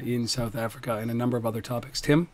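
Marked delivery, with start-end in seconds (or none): none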